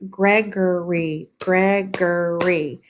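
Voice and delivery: slow "Gregory" as Greg-o-ry; syllable by syllable. Shown as a demonstration. Teacher counting syllables, Gregory slowly broken apart. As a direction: separate each beat of "Gregory".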